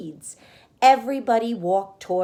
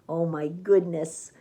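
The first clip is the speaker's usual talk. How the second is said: usual speaking voice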